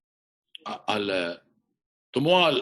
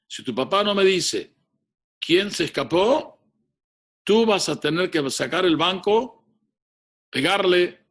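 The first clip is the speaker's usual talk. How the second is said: higher, louder threatening order; raised menacing threat; 5 semitones higher, 5 dB louder than usual. Retold or imitated. Commander threatening the boy with his father's death, raised and menacing.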